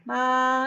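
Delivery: "ma" high, flat and held; steady, level, high-pitched "ma"; monotone first tone. Shown as a demonstration. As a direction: keep "ma" on one high note. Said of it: "It's very monotone and flat".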